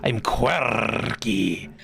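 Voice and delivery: very slow, trilled R on quirky; rolled r. Acted. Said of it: "You don't have to do it for every single R".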